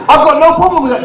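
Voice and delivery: normal talking voice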